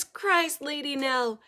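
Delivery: plain reading voice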